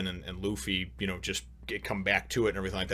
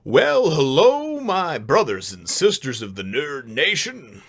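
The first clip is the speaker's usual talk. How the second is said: drawn-out, swooping host greeting; hammed-up slow announcer opening; show intro; 5 semitones higher, 5 dB louder, slower than usual. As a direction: welcome the fans with big showy emphasis. Opens his review show.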